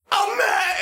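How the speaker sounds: high, crying yell; angry shout. Retold or imitated. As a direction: scream it in mock rage. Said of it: "they're like".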